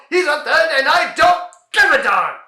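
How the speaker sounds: loud, exasperated retort; heated outburst. Acted. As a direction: snap it out in frustration. Heated outburst in comedy routine.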